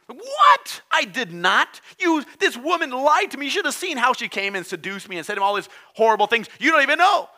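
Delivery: a high, loud, outraged denial; indignant Adam. Acted. Preacher acting Adam blaming the woman before God. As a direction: protest your innocence loudly.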